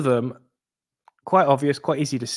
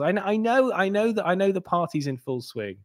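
ordinary talk, nothing marked

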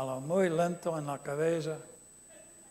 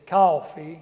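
loud Southern-style "Coffee"; Southern accent; 9 dB louder than usual. Shown as a demonstration. Preacher showing the congregation how the South says coffee, Southern accent. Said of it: "is from the South".

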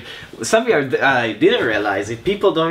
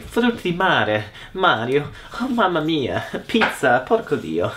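put-on Mario accent; 3 semitones higher than usual. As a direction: sing it out like Mario. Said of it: "the Mario accent".

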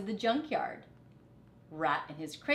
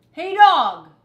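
much higher, much louder call; 9 semitones higher, 18 dB louder than usual. A narrator's reading. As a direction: call across the junkyard. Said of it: "Rat called".

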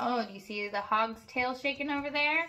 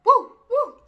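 high, loud mock bark; barking dog; 13 semitones higher, 9 dB louder than usual. The daunted dog barking, high and loud. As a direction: yap like a spooked dog. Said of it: "who barked".

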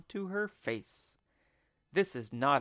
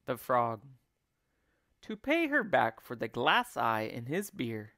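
ordinary delivery, nothing marked

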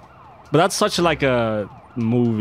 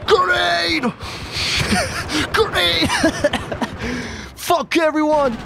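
very high, strained squeal of a voice; shrieking game character; slower than usual. Acted. Player voices arrest scene and grenade fight, high shrieking character voice. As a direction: screech it out far above your own pitch, breaking into laughs.

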